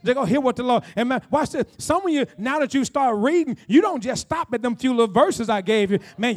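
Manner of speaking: ordinary delivery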